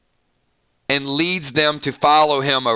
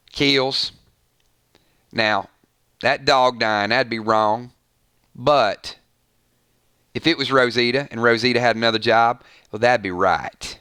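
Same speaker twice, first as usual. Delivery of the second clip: lightly imitated Deep South accent, pitched lower; Amanda and Maggie, Southern accent; 6 semitones lower than usual. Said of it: "if Amanda and Maggie were to get together and discuss that, it would probably be something like".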